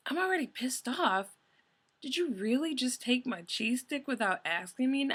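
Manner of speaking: can't believe she just did that; incredulous outrage. Retold or imitated. Venting about friend stealing cheese stick, incredulous.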